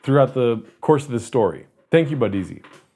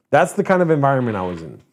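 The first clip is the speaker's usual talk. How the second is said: usual speaking voice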